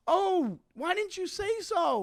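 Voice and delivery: high, slow fawning voice; hammed-up delighted mentor; mock gushing. Comic mocking what the heckler hoped he'd say, high and gushing. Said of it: "expecting me to go like this".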